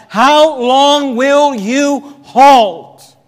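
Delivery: high, loud, solemn challenge. Acted. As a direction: stretch every word out at the congregation. Preacher puts prophet's challenge to congregation.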